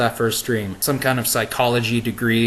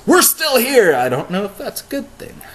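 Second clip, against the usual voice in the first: mock surprise; 12 semitones higher, 5 dB louder than usual. Real, not acted. Joking about rapture.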